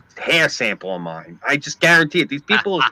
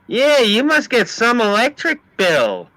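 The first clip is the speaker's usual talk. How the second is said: high, drawn-out admiring female voice; gushing woman impression; 10 semitones higher, slower than usual. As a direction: coo it slowly, way up high. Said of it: "she goes like this to me".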